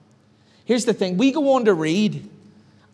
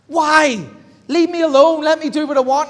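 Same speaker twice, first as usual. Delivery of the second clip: put-on higher and louder, a stroppy child; hammed-up toddler protest, higher; whiny toddler; 7 semitones higher, 5 dB louder than usual. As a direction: throw a little tantrum at God. Believers voiced as whiny toddler.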